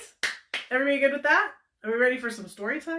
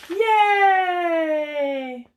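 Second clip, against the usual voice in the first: high, loud cheer; bright loud yay; 8 semitones higher, 8 dB louder than usual. Real, not acted. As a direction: cheer for the winner. Cheering for the winner.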